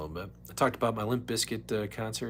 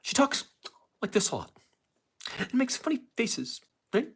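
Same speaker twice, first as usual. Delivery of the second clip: lightly voiced, higher news-anchor tone; a hint of the TV host, pitched up; Rachel Maddow impression; 12 semitones higher than usual. Streamer showing how Rachel Maddow talks, higher. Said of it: "I'm trying to do a Rachel Maddow impression".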